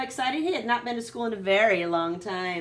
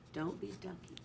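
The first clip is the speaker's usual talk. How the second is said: much softer, hushed close; quiet final rule; soft aside; 12 dB quieter than usual. A narrator's reading. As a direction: drop to a hush. Rule book ending.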